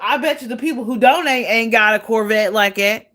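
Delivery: usual speaking voice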